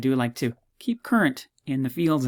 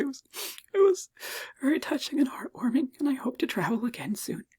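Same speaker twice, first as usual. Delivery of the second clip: high, squeaky whispered sob; mock crying; 12 semitones higher, 3 dB quieter than usual. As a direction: whimper as if too choked up to speak. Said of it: "stop crying".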